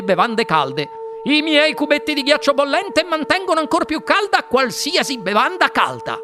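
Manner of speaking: high, excited sales patter; boastful inventor. A narrator's reading. (inventore entusiasta che vanta i suoi prodotti, voce acuta)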